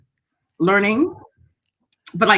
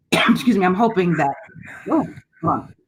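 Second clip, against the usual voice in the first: choking, voice strained mid-sentence; struggling to talk through a choke; swallowed wrong way. Real, not acted. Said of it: "I swallowed the wrong".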